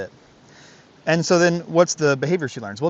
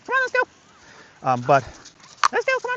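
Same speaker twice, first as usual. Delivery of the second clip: much higher, slow call to the dog; high sing-song call. Real, not acted. Trainer calls his dog along the trail, high and slow.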